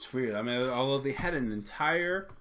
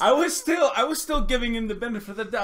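much higher, fast and eager; excited rush; 11 semitones higher, 10 dB louder, faster than usual. Real, not acted. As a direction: rush it, worked up.

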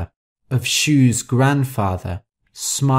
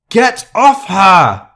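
loud, high, drawn-out roar; 8 semitones higher, 8 dB louder, slower than usual. A narrator's reading. Elizabeth pulling Rosa off Shu, roaring. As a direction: roar the order with full force. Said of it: "Elizabeth roared".

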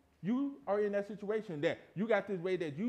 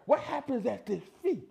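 normal talking voice

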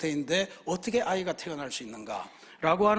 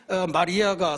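normal talking voice

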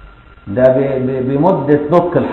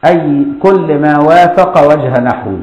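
verse recitation; 4 dB louder, slower than usual. Shown as a demonstration. Recites the poem line again.

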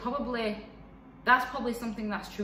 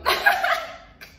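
high breathy laugh; a real unvoiced laugh; burst of laughter; 8 dB louder than usual. Real, not acted. Laughing at her own seafood dislike.

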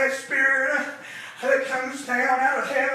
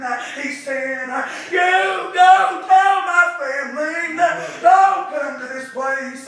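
preaching; 5 dB louder than usual. Acted. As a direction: preach it out to the congregation with rising fire. Preacher chanting to congregation.

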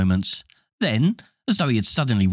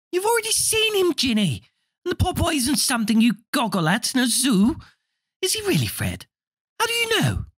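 mother voice. Acted.

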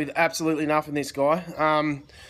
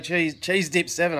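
ordinary talk

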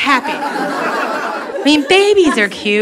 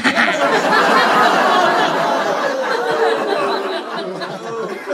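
big laugh; 7 semitones higher than usual. Real, not acted. Laugh after own joke.

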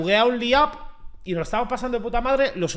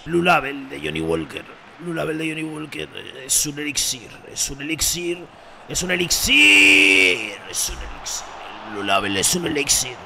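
mock whisky ad; 6 semitones lower than usual. Streamer hamming up a whisky advert voice. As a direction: sell it like a luxury liquor advert.

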